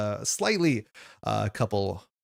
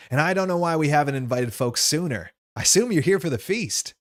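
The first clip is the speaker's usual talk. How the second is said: eager villager, higher and louder; Theo; 5 semitones higher, 5 dB louder than usual. Acted. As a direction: pitch it up like a keen young host. Voices Theo continuing.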